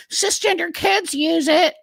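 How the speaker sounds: very high-pitched, drawn-out mock parroting; mocking mimicry. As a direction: slowly parrot their argument far above your natural pitch. Mimics the opponents' recurring argument.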